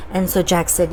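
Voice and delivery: ordinary delivery, nothing marked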